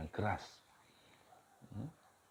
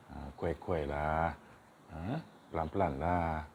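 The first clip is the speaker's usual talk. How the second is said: ordinary delivery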